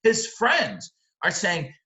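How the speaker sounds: ordinary delivery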